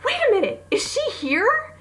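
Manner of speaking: a sudden shocked recognition, voice leaping high; surprised, high. Retold or imitated. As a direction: gasp then squeal it out in disbelief. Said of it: "And I go, gasps".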